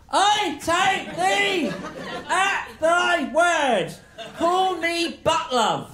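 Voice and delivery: high, very slow, robotic syllables; Dalek-like staccato reading. Acted. Struggling Romeo answering Juliet, Dalek-like staccato. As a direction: grind out each word separately, pitched high. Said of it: "He talks like a Dalek".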